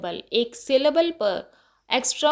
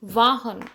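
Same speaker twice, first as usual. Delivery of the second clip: वाहन, weight on वा; stress on first syllable; much slower than usual. Shown as a demonstration. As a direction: linger on the वा in वाहन. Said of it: "वा को थोड़ा loud बोलते".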